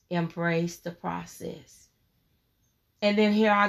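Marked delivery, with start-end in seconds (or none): 0.1-1.9 soft, low and still, like a whisper from God; still, calm voice. Retold or imitated. God answers her struggle in a still, calm voice. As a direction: let the words settle gently, softer. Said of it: "in a still, calm voice".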